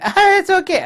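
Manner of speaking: high, loud sing-song brush-off; mock the crowd shrugging it off; mocking dismissal. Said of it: "and then everybody".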